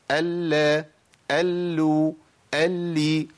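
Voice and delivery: each "ال" with a doubled L held equally, released into a vowel; evenly timed lam. Shown as a demonstration. Teacher drilling the three vowels for students, each "ال" evenly held. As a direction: give every "ال" the same measured hold on the L. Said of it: "نفس الزمن".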